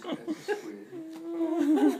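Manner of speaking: high, slow, laughing squid reveal; giggling, high and drawn out. Real, not acted. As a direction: laugh it out, dragging the words.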